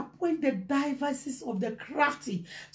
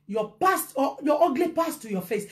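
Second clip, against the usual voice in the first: usual speaking voice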